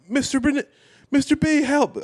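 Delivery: high, whiny cry for help; mock plea. Teen begging dad's friend for help while hauled off, mock plea. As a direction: squeal for help like a scared kid. Kid begging as he's led away.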